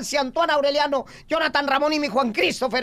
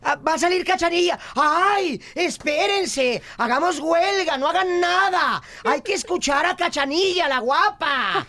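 gushing excited; 6 semitones higher than usual. Acted. Gushing over Cachanilla.

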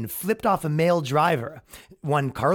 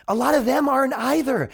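mock retort; 9 semitones higher than usual. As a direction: fire back in a high, exasperated tone. Acted reply in argument.

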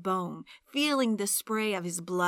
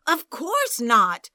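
indignant denial; 3 semitones higher, 9 dB louder, slower than usual. Acted.

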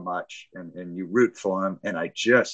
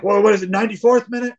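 much higher, louder and fast; frantic fan asking the time left; frantic question; 11 semitones higher, 8 dB louder, faster than usual. Retold or imitated. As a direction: blurt it out in panic. Anxious question about match time.

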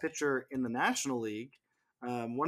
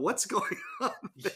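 laughing; 10 semitones higher than usual. Real, not acted. Teasing about a player's impression.

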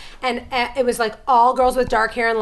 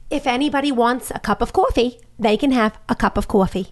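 put-on New York accent. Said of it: "Here's how Michael Blinderman talked".

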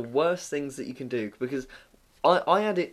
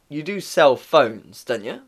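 slow, drawn-out "sell phones"; mock customer's pointed, doubting query; pointed question; 5 dB louder, slower than usual. Customer to phone shop assistant, slow and pointed.

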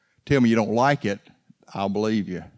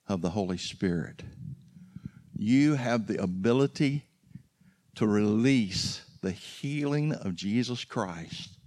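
hushed preaching; 8 dB quieter than usual. Real, not acted. Preacher leaves the congregation with one thought, hushed preaching. Preacher's solemn closing charge.

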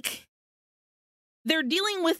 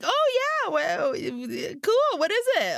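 much higher, airy mock innocence; fake-casual stranger; 8 semitones higher than usual. Moto woman playing innocent to the clerk, high mock-chirpy voice. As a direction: play dumb, chirpy, covering the trick. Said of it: "and then she's like".

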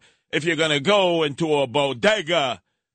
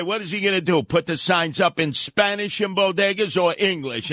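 usual speaking voice

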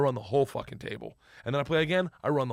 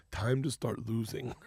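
low, slow gangster warning; imitated mobster, lowered and unhurried; quiet menacing order; 4 semitones lower, 7 dB quieter, slower than usual. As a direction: give a soft, deadly serious order. Igor's menacing order, retold.

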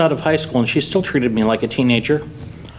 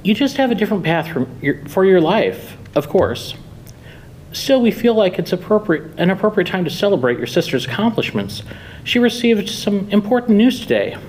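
higher-pitched, chirpy mother voice; 7 semitones higher than usual. Acted. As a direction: keep chirping like a mother excusing herself. Mother keeps talking in her voice.

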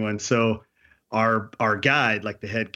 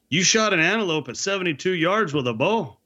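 put-on higher-pitched Canadian bush accent; backcountry Canadian accent; 7 semitones higher than usual. Owner questions hunter about missed shot, backcountry Canadian accent. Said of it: "you're doing the accent".

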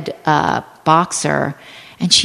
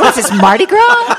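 high, loud, laughing sarcastic question; laughing mock disbelief; 13 semitones higher, 5 dB louder than usual. Teller mocking the neighbors' party, laughing high and loud. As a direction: scoff through a laugh, high and slow. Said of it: "and I'm like".

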